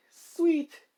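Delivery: high, loud burst of joy; thrilled shout. Acted. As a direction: yell it with glee. Allowed to use balance breaker.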